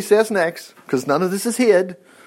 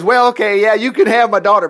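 raised, louder giving-in; grudging Saul; 4 semitones higher, 6 dB louder than usual. Acted. King Saul finally handing over his daughter, loud reluctant consent. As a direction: give in loudly, as if pestered into it. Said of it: "when he finally says".

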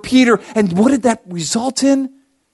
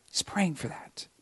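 whisper; 2 semitones lower, 18 dB quieter than usual. Shown as a demonstration. Preacher whispers the repeated line to the congregation. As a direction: breathe it out, unvoiced, to the congregation. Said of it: "repeats line as a whisper".

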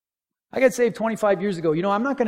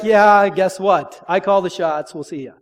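unimpressed comeback; 2 semitones higher, 11 dB louder than usual. Retold or imitated. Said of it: "he's gonna be like".

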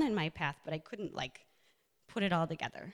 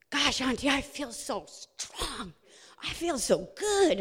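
lightly voiced excited little boy, higher and slow; imitating nephew; 5 semitones higher, 4 dB louder, slower than usual. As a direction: mimic the nephew bragging after a workout. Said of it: "he goes".

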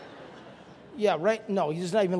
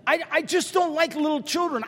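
ordinary talk, nothing marked

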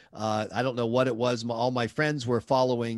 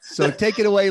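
laughing; 7 semitones higher, 8 dB louder than usual. Real, not acted. Host laughing at guest's joke.

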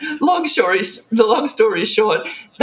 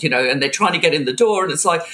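ordinary delivery

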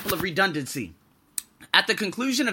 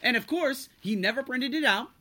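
usual speaking voice

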